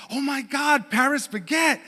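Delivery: retold squeal of recognition at a familiar place; excited. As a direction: gush with delight, mildly hammed-up. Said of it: "she gets all excited. She's like".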